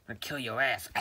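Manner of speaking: loud repeat of the threat. Acted. Threat said twice.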